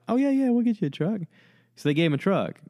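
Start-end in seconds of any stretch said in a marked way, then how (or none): 0.1-1.2 high, fast, eager "sure thing" voice; mock eager corporate guy; eager yes-man. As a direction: cave in with a bright squeaky yes. Chevy people caving in eagerly.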